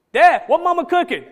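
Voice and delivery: put-on high, loud eager kid asking; child's voice. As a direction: play an eager kid calling dad. Voicing his child.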